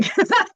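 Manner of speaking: short, high burst of laughter; high, self-mocking laugh; amused laugh. Real, not acted. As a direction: laugh at your own bad singing. Joking partner dislikes her singing.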